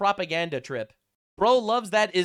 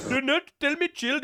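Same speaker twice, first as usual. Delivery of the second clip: put-on jokey tough-guy Canadian accent, pitched higher; mock hardcore Canadian accent; 6 semitones higher than usual. Streamer parroting the attacker from the clip, mock Canadian accent. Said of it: "This is my hardcore Canadian accent".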